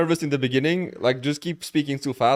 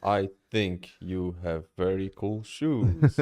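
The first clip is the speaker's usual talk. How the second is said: very slow, lower and softer; mimicking the coach's slow deep opener; mock calm tone; 5 semitones lower, 6 dB quieter, much slower than usual. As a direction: parody a forced slow masculine drawl. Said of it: "let's try his thing".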